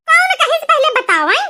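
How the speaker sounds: ordinary talk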